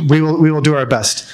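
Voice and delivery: ordinary talk, nothing marked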